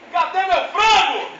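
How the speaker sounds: high, slow yell for the lost frango; retold yelling. A boy yelling in the dark kitchen for his chicken, high and slow. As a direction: stretch out "frango" in a desperate high call. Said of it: "Fiquei gritando igual doido na cozinha".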